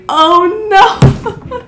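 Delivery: high, pinched panic at being spotted; mock horror, voice jumping up; dismayed oh no. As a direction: squeak out your dread. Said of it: "And I'm like".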